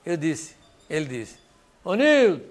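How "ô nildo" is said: high, loud call across a hall; retold shout of greeting across the big hall; shouting a name. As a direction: call him out loud from the doorway. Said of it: "Entrou gritando lá no salão".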